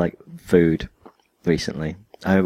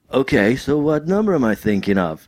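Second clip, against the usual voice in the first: lightly acted higher dude voice; Bill and Ted; 6 semitones higher than usual. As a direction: quote a questioning movie dude, pitched up. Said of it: "And they're like".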